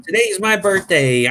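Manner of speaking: put-on high, slow kid's voice retelling; excited little kid. Retelling a young boy telling Shannon it's his birthday, excited kid voice. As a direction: proudly announce it like a small child. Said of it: "it was actually the day of his birthday".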